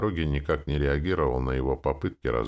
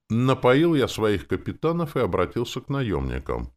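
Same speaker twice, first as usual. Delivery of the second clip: ordinary delivery, nothing marked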